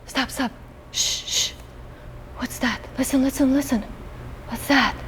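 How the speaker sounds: very soft, hushed stops; hushed whisper. Acted. Teacher whispering to children to listen. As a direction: whisper so nobody hears us.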